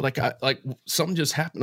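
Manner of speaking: usual speaking voice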